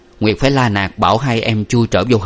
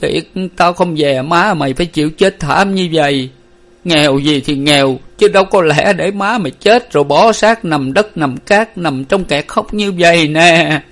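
choked lament; 8 semitones higher, 4 dB louder than usual. A narrator's reading. Father mourns his wife's wretched death, choked lament. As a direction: lament aloud, voice rising in sorrow. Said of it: "Ông Năm nghẹn ngào kể lể".

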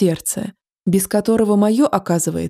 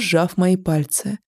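usual speaking voice

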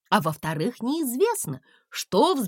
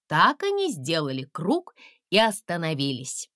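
ordinary delivery

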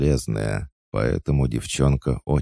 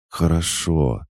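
ordinary delivery, nothing marked

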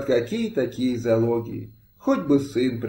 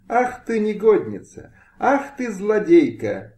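much higher, shrill-pitched furious exclamations; furious outcry; 8 semitones higher than usual. A narrator's reading. Lady confronts her rival on the porch, higher furious cry. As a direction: hurl the insults at her rival. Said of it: "вскричала пани Мыльская".

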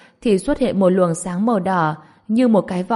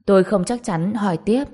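ordinary delivery, nothing marked

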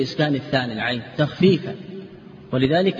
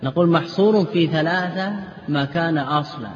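ordinary delivery

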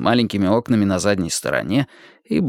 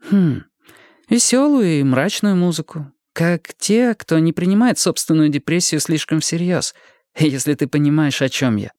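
higher-pitched woman's dialogue voice; Марта в разговоре; 6 semitones higher than usual. Acted. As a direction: voice Martha higher, settling toward the end. (голос Марты, повыше)

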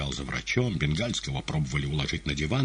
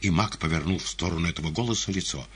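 ordinary delivery

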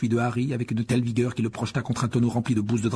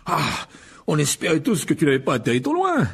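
higher, winded character voice; out of breath; 5 semitones higher, 4 dB louder than usual. Acted. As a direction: gasp it out after running up. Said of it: "s'exclama Monsieur Weasley hors d'haleine". (voix haletante et soulagée)

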